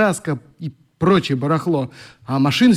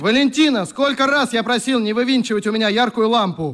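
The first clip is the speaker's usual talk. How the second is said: higher-pitched annoyed complaint; irritated scolding; 7 semitones higher than usual. Acted. Brother scolding sister about the lamp, annoyed. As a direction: scold her sharply.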